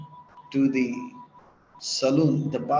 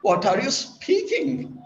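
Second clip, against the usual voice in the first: incredulous; 9 semitones higher than usual. Acted. Barber baffled by the claim.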